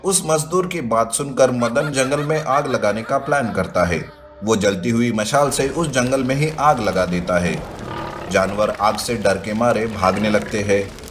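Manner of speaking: usual speaking voice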